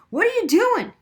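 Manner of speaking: high, loud alarmed question. A narrator's reading. Daniel seeing the girl sob, alarmed. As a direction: react in alarm. Boy alarmed by her crying.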